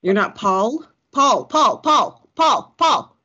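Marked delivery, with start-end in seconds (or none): 1.1-3.2 rising sing-song name calls; calling out. Acted. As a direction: call the name over and over, climbing.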